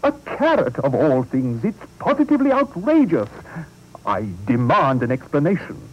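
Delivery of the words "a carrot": much higher, shrill disbelief; the carrot tops it all; mayor's outrage peaks higher; mounting outrage. Acted.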